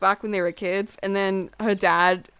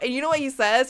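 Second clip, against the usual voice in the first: ordinary talk, nothing marked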